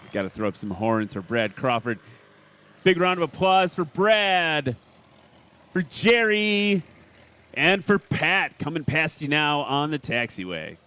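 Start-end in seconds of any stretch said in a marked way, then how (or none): 2.8-9.9 announcer call-out. Real, not acted. Calling crowd applause for pilots.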